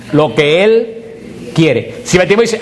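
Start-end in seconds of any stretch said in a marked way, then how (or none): none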